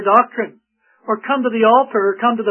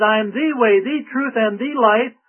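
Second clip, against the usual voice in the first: every "the" said as an emphatic "thee"; measured list, leaning on each "the" as the only one; stress on each THE. Retold or imitated. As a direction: hit "the" before "way", "truth" and "life". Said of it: "not one of the ways".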